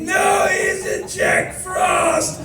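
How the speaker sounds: very high, slow squeak; high squeaky reply. Retold or imitated. Crying man correcting his friend, slow and high. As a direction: stretch it thin and squeaky.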